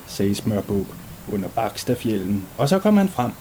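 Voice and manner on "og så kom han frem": ordinary delivery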